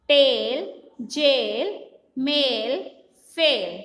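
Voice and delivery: slow spelling chant, each word stretched; phonic drill. Shown as a demonstration. Teacher drilling children in phonics, measured chant. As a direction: spell it out in a measured sing-song, last word falling.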